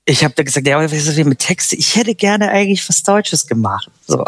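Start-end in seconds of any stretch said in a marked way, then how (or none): 1.8-3.9 retelling a meek request in a higher, sheepish voice. Retold or imitated. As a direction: ask it sheepishly, almost apologetic. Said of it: "so ganz kleinlaut".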